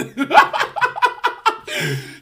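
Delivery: sharp high-pitched laugh; real amused cackle; high burst of laughter. Real, not acted. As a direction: break up laughing at the absurdity. Laughs at his own story.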